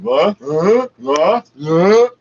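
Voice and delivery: imitating a flustered employee playing dumb; mock confused huh. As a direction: play dumb, rising what. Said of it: "He went".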